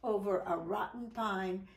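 ordinary delivery, nothing marked

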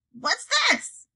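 put-on troll mother asks about the baby; troll Marge asking. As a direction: poke at the basket with curiosity. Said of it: "she said".

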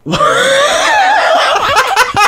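high squeal of laughter; shrieking laughing fit. Real, not acted. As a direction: burst into a long, high laugh. Laughs at the game.

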